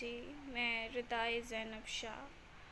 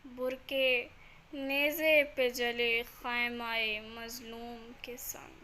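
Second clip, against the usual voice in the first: louder, projected verse, each line dropping at the end; elegiac poem recitation; 3 semitones higher, 10 dB louder than usual. Acted. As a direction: declaim the salaam line by line, with pauses. (बुलंद आवाज़ में सलाम पाठ)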